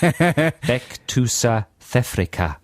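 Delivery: slow phonetic South African; South African accent. Acted. Trying the phonetic phrase himself, South African accent. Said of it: "you're doing it as well".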